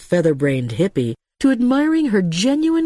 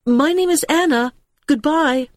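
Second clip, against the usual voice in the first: higher call over the mist; a raised, impulsive farewell call; 8 semitones higher than usual. A narrator's reading. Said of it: "some impulse made her call".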